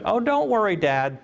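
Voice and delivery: put-on high, sweet child's reply, voice jumping up; a small girl's high chirpy answer; little daughter's voice. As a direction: answer brightly like a carefree five-year-old. Father voicing young daughter.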